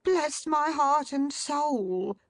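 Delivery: high, slow flustered cry; agitated cry. A narrator's reading. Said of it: "cried Mister Fish".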